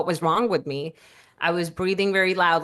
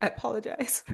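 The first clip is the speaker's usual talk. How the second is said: choked up; 4 semitones higher, 9 dB quieter than usual. Real, not acted. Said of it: "it makes me emotional".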